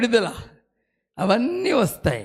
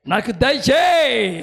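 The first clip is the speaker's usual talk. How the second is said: louder, higher, slow fervent plea; crying out in prayer; 4 semitones higher, 6 dB louder, much slower than usual. Retold or imitated. Preacher reenacting prayer, now a loud slow plea. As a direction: cry the same plea out loud. Prayer repeated, rising to a cry.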